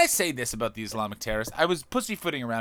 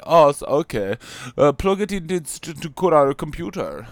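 put-on a light Baltic accent, higher and louder; mock Baltic accent; 5 semitones higher, 7 dB louder than usual. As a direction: play a confused Baltic fan in a mild Baltic accent. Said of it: "Estonian, Latvian, or Baltic accent".

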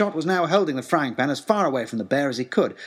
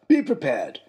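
father's voice; 2 semitones higher than usual. Retold or imitated. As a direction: play the father proudly quoting his motto. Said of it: "is what he said".

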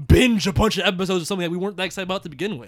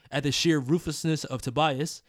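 normal talking voice

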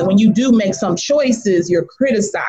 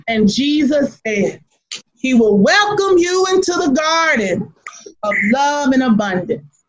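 preaching; 4 semitones higher than usual. Retold or imitated. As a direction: build the sermon line to a shout. Grandma preaching after the biker incident.

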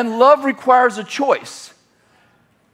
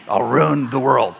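mock devil; 8 semitones lower than usual. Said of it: "My rendition of the devil".

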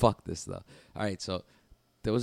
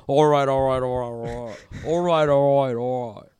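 loud, higher mock tap-out chant; imitating UK visitor; 4 semitones higher, 8 dB louder than usual. Mimicking UK visitor submitting without saying tap, mock urgent chant. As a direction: hammer out the repeated words as a caricature. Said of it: "this guy said".

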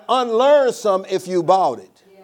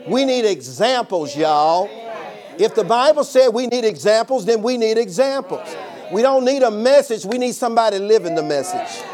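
rising, louder preaching cadence; 5 semitones higher, 5 dB louder than usual. Acted. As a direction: build the point like a pulpit sermon. Preacher drives point home.

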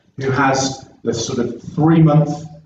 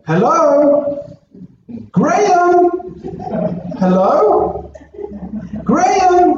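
very high-pitched calling, each word sustained; imitating mum's voice; much slower than usual. Son mimicking his mum's call accidentally played in church, high calling voice. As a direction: mimic a mother calling down the phone. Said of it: "this voice went".

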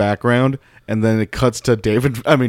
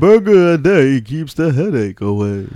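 character impression; 4 semitones higher, 3 dB louder than usual. Host playing along with the burger character, mimicked voice. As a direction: carry on the mock character voice. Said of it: "You kinda sound like a mixture of him and".

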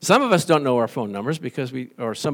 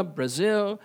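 ordinary talk, nothing marked

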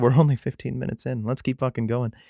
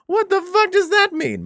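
much higher, incredulous squeak; can't believe what you just read; baffled outburst. Real, not acted. Baffled by the verse.

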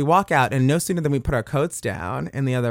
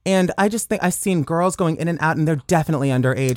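put-on, higher-pitched complainer; prim mock caller voice, raised in pitch; concerned-neighbor phone voice; 5 semitones higher than usual. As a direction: play a Karen reporting the kids next door. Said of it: "the voice you were doing on the phone".

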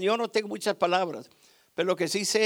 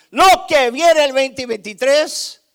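loud, raised-pitch exhortation; full-force preaching; 4 semitones higher, 9 dB louder than usual. Real, not acted. Preacher warns church about the coming year, full-force preaching.

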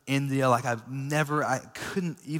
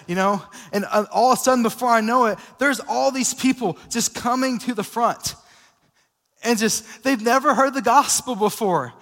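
louder, higher storytelling from the pulpit; fiery preaching; 6 semitones higher, 7 dB louder than usual. Acted. Pastor telling the congregation about the crusade, fiery preaching. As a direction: preach it with fire, building excitement.

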